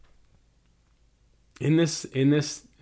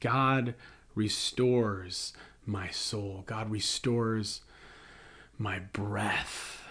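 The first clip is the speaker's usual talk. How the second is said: slow and much softer, almost prayed; soft prayerful; 4 semitones lower, 8 dB quieter, slower than usual. Real, not acted. Pastor leading the church in a breath, softly and slowly. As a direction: let it settle gently, like a breath. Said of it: "Everyone take a breath".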